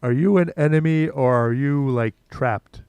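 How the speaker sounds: loud call into the mist; shouting. Acted. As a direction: shout it across the grotto. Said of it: "I would like to shout".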